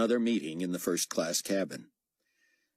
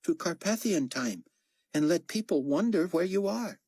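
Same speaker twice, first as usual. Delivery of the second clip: raised, lightly voiced character line; 12 semitones higher than usual. A narrator's reading. Chang proposing a trick to the team, lightly voiced. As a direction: pitch it up for the character. Character dialogue, read aloud.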